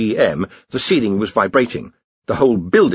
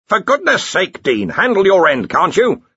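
higher, exasperated wizard voice; bickering wizard; 9 semitones higher, 4 dB louder than usual. A narrator's reading. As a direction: bicker with a colleague over the work. Two wizards arguing over a door.

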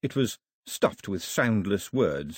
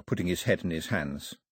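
ordinary delivery

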